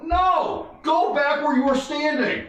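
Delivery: high, drawn-up refusal; exasperated cop order. Retold or imitated. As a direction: imitate officers fed up with a drunk. Officers refuse him once more.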